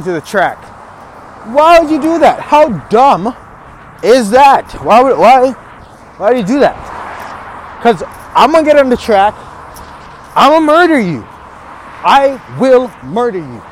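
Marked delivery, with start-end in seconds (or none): 1.5-11.2 incredulous, worked-up trash talk; mock outrage. Berates life for tripping him. 12.0-13.6 slow, deliberate playful menace; measured trash-talk threat; mock threat. Threatens life, word by word.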